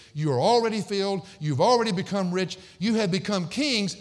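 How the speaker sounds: burning indictment. Acted. Preacher reading Paul's charge against Corinth, burning indictment. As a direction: drive each charge home high and loud. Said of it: "the burning indictment of that verse".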